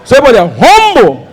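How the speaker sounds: normal talking voice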